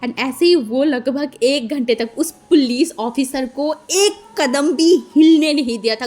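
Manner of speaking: usual speaking voice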